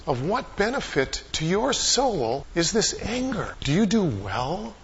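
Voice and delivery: patient acted reproof, kept gentle; gentle questioning. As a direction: ask it tenderly, letting it rise at the end. God gently questions angry Jonah.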